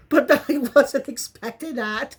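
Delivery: incredulous, cracking up while talking; laughing. Real, not acted. Vlogger still surprised by Rita's headbutt, laughing. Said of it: "couldn't help but keep laughing".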